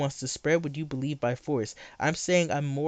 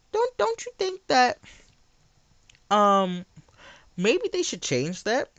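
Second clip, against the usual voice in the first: incredulous high voice; 7 semitones higher, 3 dB louder than usual. Real, not acted.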